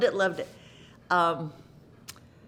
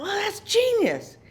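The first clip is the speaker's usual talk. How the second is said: higher, slow, admiring "genius"; impressed thought; 12 semitones higher than usual. Retold or imitated. Recalling reading the character, impressed and admiring. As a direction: marvel at the writing. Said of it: "I thought".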